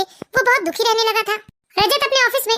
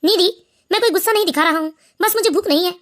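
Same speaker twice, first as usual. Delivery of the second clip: much lower, tired man's voice; weary husband; 4 semitones lower than usual. Acted. Husband tells his wife he is not hungry, low and weary. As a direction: push the food away, worn down.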